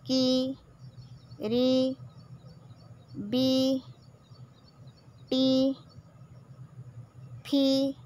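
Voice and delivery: "की", "री", "बी", "टी", "फी" each said clearly and held; syllable reading. Shown as a demonstration. Teacher models long-i syllables for children, clear and deliberate. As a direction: sound out each syllable for the class. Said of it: "उसको भी हम".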